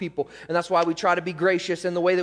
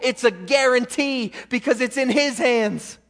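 higher, louder preacher's declaration; full-force preaching; 7 semitones higher, 6 dB louder than usual. Acted. Preacher assures people of future glory, full-force preaching. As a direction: proclaim it as a guarantee.

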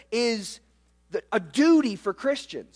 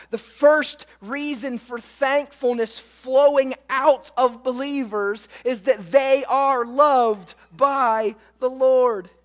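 usual speaking voice

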